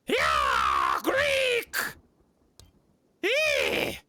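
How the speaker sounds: high, loud wild shrieks; shrieking. Pretend. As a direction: screech like a feral bandit. Mimicking shrieking bandits.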